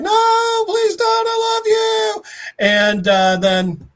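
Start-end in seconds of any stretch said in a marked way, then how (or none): 0.0-2.2 very high, hammed-up wail; desperate plea. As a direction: cry out in mock anguish, pitched way up. Acted plea as friend falls.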